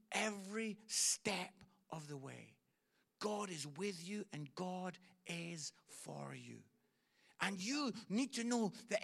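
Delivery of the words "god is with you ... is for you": ordinary delivery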